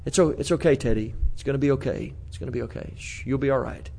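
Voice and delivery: put-on soft, hushed comfort to a crying child; calm him down gently, almost under your breath; a father hushing his son softly; soothing hush.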